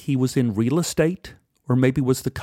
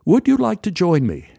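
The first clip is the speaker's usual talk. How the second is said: a polite English gent's greeting; English accent; 7 semitones higher than usual. Retold or imitated. English stranger greets narrator at the bar, lightly English. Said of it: "He, he was English".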